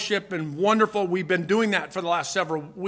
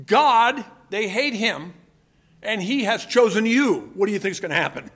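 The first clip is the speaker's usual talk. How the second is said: ordinary talk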